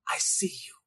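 barely voiced whisper; hushed reassurance. Apostle affirms someone who need not be extra, hushed reassurance.

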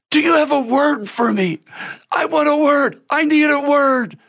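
high-pitched, eager begging of an imitated woman; excited, hungry plea. Hungry lady begging the minister for a word, high excited plea. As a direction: mimic someone desperately pressing in, pitch way up. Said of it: "like, so excited".

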